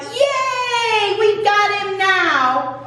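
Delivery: high, drawn-out cheer; imitating a gleeful crowd, slowly; mock celebration. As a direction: stretch it into a singsong yay. Philistines celebrate the capture.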